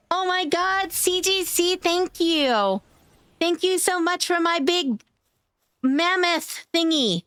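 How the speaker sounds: high, delighted gush of thanks; excited thanks. Real, not acted. Streamer thanking a viewer for a big gift, high and thrilled. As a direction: squeal your thanks for the gift.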